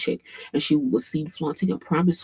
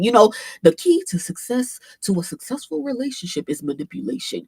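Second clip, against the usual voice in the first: higher-pitched mocking imitation of a man; mocking casual manipulator; 7 semitones higher than usual. Streamer mocking men in the clip, casual imitation. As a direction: mimic him talking like it's nothing. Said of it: "men who talk like this".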